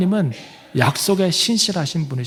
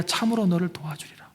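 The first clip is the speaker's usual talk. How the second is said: closing the verse in a soft whisper; 8 dB quieter than usual. Acted. Preacher reading Isaiah's promise to the congregation, whispered. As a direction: let the promise fall to a hush. Ending scripture quote hushed.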